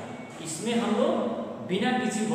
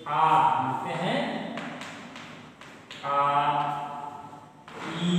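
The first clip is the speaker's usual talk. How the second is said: long drawn vowel; 3 semitones lower than usual. Shown as a demonstration. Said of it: "इसमें लंबा समय लग रहा है".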